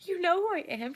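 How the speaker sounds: high, loud amazed question; starstruck disbelief. Retold or imitated. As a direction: replay a fan's astonishment at being recognized.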